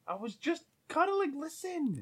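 high, soft little whine; small squeaky mock plea; pleading dog voice. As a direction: wheedle an excuse as a cuddly pet. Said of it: "Dad, listen".

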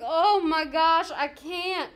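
loud, high, drawn-out exasperation; mock overwhelmed. Host reacts to the cruel follow-up film, overwhelmed exasperation. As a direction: drag it out as if you can't take it.

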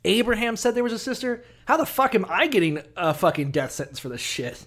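high-pitched outraged rant; mock indignation. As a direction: whine like a man unfairly condemned. Voicing Abimelech's outraged complaint to God.

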